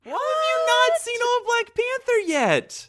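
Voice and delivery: high, loud, incredulous squawk; hammed-up scandalized outburst; mock outrage. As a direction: shriek it in exaggerated disbelief. Mock outrage at unseen film.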